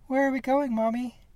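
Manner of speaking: put-on high, small child's voice, sleepy question; little girl. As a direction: ask like a drowsy little girl. Little daughter wakes up.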